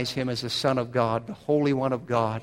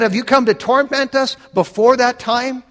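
much higher, louder cry; demons crying out; 9 semitones higher, 5 dB louder than usual. Retold or imitated. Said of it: "They said".

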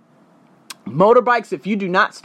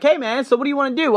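eager local; 11 semitones higher, faster than usual. Retold or imitated. Tour guide voiced in story.

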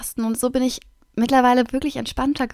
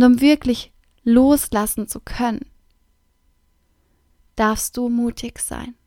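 guided breathing meditation; 4 dB louder than usual. Acted. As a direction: guide them to breathe out and let go. Leads a breathing exercise.

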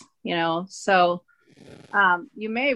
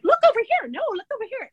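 attention-seeking rug; 14 semitones higher, much faster than usual. Retold or imitated. Wife voices busy Persian rugs, high attention-seeking squeal. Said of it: "full of themselves".

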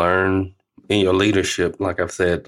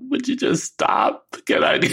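high exasperated plea. Retold or imitated. Granddad silently begging grandson to stop crying, high and strained. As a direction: squeak out a desperate inner plea. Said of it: "saying to myself".

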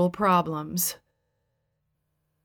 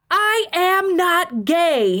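high, slow, loud outraged yell; drawn-out, high-pitched furious denial; 11 semitones higher, 5 dB louder, much slower than usual. A narrator's reading. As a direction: yell each word out indignantly. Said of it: "he yelled".

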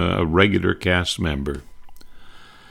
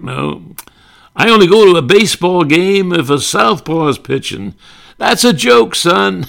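much higher, loud Southern blowhard; Southern senator; 11 semitones higher, 5 dB louder than usual. Retold or imitated. Senator Claghorn bragging about the South, loud and high. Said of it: "very Southern".

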